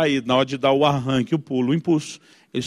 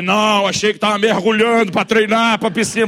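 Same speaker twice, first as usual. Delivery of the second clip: higher-pitched, exasperated sarcasm; sarcastic Lunga retort; 4 semitones higher, 3 dB louder than usual. Acted. Father's inner grouch answering the obvious, sarcastic. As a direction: fire off a mocking comeback. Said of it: "Aí o Lunga quer dizer".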